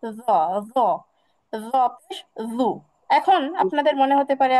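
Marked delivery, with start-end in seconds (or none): 0.0-2.7 র পেশ রু drawn out slowly, each syllable separate; slow drill. Shown as a demonstration. Teacher slowing down the syllables for learners. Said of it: "agar সঙ্গে লাগিয়ে র".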